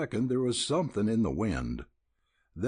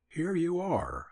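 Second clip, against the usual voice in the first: bright raised greeting, higher; sweet happy cry; 9 semitones higher than usual. A narrator's reading. Nell greeting her dad and the cowboys, a happy cry. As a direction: call out gladly on arriving. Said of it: "cried a sweet, happy voice".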